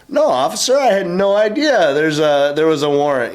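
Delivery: high-pitched, overdone innocent protest; feigned innocence. Retold or imitated. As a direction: play dumb in a high, pleading voice.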